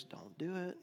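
very soft, hushed warning; whispering inner voice. Acted. Preacher voices the small interior voice that warns, hushed. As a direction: imitate a near-whispered voice of conscience. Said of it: "that whispers".

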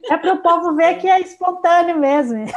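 loud laughing remark. Real, not acted. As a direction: laugh it out loudly. Joking about spontaneity.

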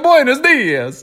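slow, high, hammed-up Italian accent; mock Italian accent. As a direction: drag it out in clownish fake Italian. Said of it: "they'll do anything in an Italian accent".

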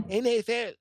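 mock Spanish-accented letters. Shown as a demonstration. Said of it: "the accent mark makes you say".

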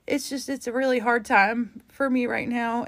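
fighting tears while telling listeners how hard it is; holding back tears. Real, not acted. Podcast host near tears, admitting a hard time. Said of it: "I'm trying to not cry 'cause it's really hard".

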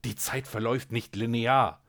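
a loud, tense hiss through the teeth. Acted. The scientist hisses at the cat, knife at Helga's throat, tense and hissing. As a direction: spit the words out like a hiss. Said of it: "zischte er".

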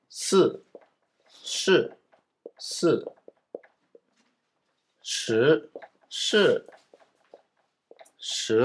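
slow, careful syllables す and し from the tongue twister; tongue twister demo. Shown as a demonstration. Teacher slowly demonstrates the し/す tongue twister to learners. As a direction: sound out each し and す deliberately. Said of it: "このしを練習する早口言葉".

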